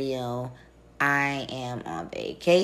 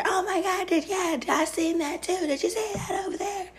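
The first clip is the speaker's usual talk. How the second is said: mock club yelling. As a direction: imitate straining to be heard in someone's ear. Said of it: "you're yelling in somebody's ear".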